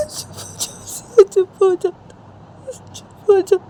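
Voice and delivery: high, loud sobbing pleas; crying out loud, voice breaking. Acted. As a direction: beg her to stop through tears. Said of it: "रोते रोते जिया को बोलती है".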